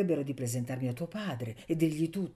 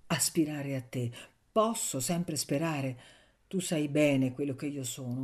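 plain reading voice

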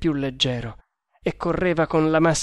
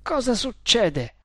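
higher, slow, scared asking; frightened question; 8 semitones higher, slower than usual. A narrator's reading. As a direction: ask in fear what is happening.

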